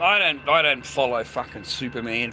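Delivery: ordinary delivery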